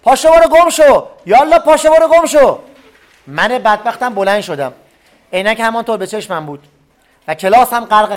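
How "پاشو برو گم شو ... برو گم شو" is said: high, loud yell driving him out; furious yell. Acted. Enraged teacher shouting at the student to get out. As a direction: throw him out of the room at full voice. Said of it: "در چنین حال خطاب کرد".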